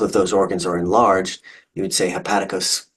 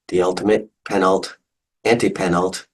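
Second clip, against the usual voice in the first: ordinary talk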